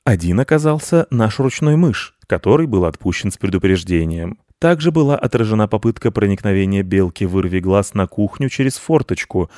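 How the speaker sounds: cat playing a newsreader, formal and loud; pompous mock news broadcast; mock news read. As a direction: announce it like an evening bulletin. Cat reads news like announcer.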